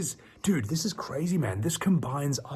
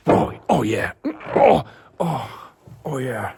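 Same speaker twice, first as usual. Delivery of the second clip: big groaning "oh" bursts; loud stretching groans; 9 dB louder, slower than usual. Real, not acted. Streamer stretching in his chair, loud groaning. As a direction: let out loud, relieved groans. Stretching, then apologises for noises.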